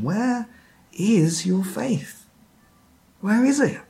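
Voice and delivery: earnest, searching question, slow on the faith part; sincere question. Shown as a demonstration. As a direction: ask it gently, really wanting an answer. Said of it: "actually asking them a question".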